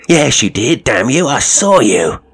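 loud frightened accusation; cried out. Acted. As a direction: shout it, panicked and high. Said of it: "he cried".

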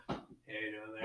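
low-volume close-talker murmur; soft imitation of a close talker; imitated close talker. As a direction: murmur it as if standing too close. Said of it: "He was a close talker".

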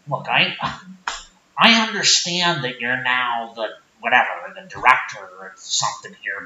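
low-pitched sheepish small-town guy; Rick character. Acted. Rick asks not to insult family.

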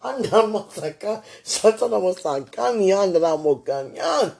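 rapid rhythmic tongues, rising pitch; speaking in tongues. Real, not acted. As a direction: pray in tongues, fervent and loud. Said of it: "I gotta speak in my heavenly language".

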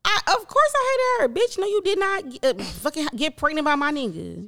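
shrill-free mock outrage at her; high indignant outburst. Real, not acted. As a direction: pitch jumps high, heated and indignant. Angry outburst about rival.

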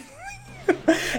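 high, bright burst of laughter. Real, not acted. Laughing at classmates playing dumb. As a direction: crack up.

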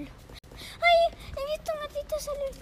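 startled cry. Real, not acted. Startled by a kitten running out.

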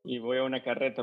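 normal talking voice

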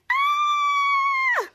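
piercing high scream. Retold or imitated. Piper startled by Schwartz, piercing high scream. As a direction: shriek as if scared out of your wits. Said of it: "Piper screaming again".

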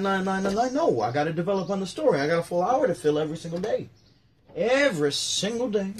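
Rodney brushing off the interviewer, exasperated; mock Rodney rant. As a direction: grumble like a put-upon comedian. Acts Rodney answering interviewer.

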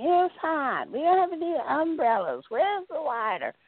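hammed-up bratty moaning; whining.

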